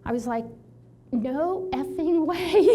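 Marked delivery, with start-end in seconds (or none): 1.1-2.6 high, loud, slow disbelief; incredulous refusal. Retold or imitated. She refuses to adjust her mentor, high and incredulous. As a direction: stretch it out in disbelief.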